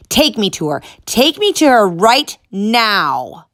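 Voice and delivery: loud, slowing, rising fury; building fury. Acted. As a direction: demand it, growing angrier. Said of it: "more furious with every word".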